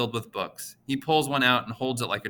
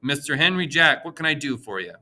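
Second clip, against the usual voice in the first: nasal voice; 5 semitones higher, 5 dB louder than usual. A narrator's reading. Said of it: "he says in a nasally voice".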